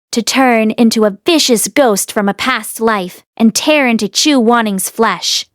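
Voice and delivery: usual speaking voice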